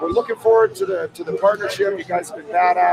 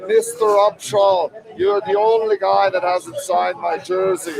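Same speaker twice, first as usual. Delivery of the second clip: slow, drawn-out fan voice; slow mock fan demand; big Russian fan; slower than usual. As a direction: lightly mimic the hulking fan. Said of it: "he'd just be like".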